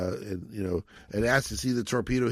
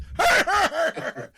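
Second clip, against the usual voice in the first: imitated single high laugh from far off; one guy laughing alone at the back; a mock lone chuckle from the crowd; lone distant laugh; 4 dB louder than usual. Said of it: "one laugh from way off in the distance".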